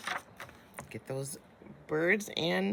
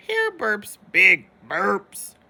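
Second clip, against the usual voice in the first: playful burps; 9 dB louder, slower than usual. Acted. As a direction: ham up the burping. Reading playful burping line.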